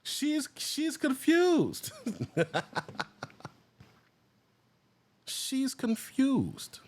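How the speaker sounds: stretched out slowly, laughing; slow, amused; amused drawl. Real, not acted. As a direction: drag it out while cracking up. Laughing at girl's face.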